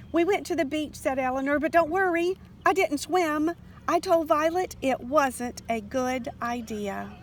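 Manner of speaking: hurrying to calm a worried mother; eager reassurance. A narrator's reading. Child rushing to reassure mother.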